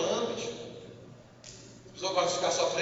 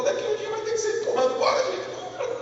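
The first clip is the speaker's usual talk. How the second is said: high, laughing mock nagging; a hint of a wife herding people out; teasing wife; 11 semitones higher than usual. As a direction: push them out with a laugh. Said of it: "A esposa dele falou".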